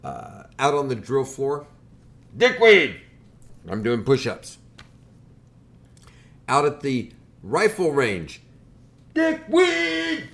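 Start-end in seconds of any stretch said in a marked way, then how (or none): 2.3-3.0 loud barked insult, much higher; drill sergeant yell. Retold or imitated. Drill instructor yelling the nickname at recruit. 9.1-10.3 piercing high bellow, much louder; drill sergeant yell. Retold or imitated. Drill instructor bellowing at recruit across range.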